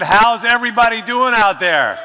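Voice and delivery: loud shout to the crowd; crowd call repeated. Real, not acted. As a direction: throw it out to the room. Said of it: "Much better".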